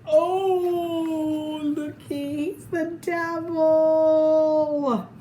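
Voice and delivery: delighted surprise. Real, not acted. Reader showing the kids the devil costume picture, slow amazed exclaim. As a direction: point out the picture in wonder.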